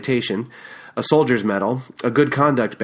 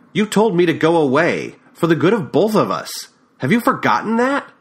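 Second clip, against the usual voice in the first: upset; 6 semitones higher, 3 dB louder than usual. Acted. Heated quarrel between ex-lovers.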